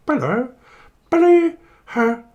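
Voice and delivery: very high, loud silly voice; mock phone caller. Pretending to answer the home phone, high silly caller. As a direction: squeal down a dodgy phone line. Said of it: "I'm gonna pick up the home phone".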